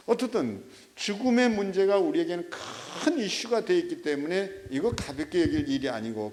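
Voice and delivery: ordinary talk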